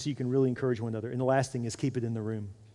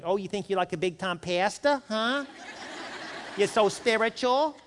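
light mock Italian accent, rising and teasing; 7 semitones higher, slower than usual. Friend's wife mocks the pastor in a light Italian accent. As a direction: tease him in a hint of an Italian accent. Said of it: "she's super Italian".

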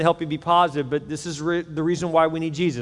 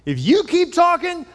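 he's finally lost patience; exasperated dad; 12 semitones higher, 4 dB louder than usual. Acted. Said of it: "he jumps up and he says".

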